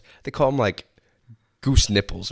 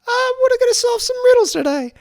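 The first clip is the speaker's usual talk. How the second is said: very high, loud cartoonish squeal; J P Riddles; 7 dB louder than usual. Guest guessing at an unknown podcast character, squeaky silly voice. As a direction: squawk it out as a silly made-up character. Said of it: "I wanna hear your impression of what J P Riddles sounds like".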